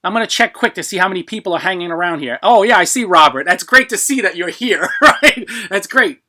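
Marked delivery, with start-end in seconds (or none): 3.4-6.2 laughing loudly while talking. Real, not acted. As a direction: break into a big laugh mid-sentence. Laughing at winner's presence.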